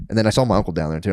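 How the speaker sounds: ordinary delivery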